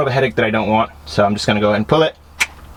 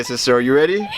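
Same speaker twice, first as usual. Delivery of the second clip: usual speaking voice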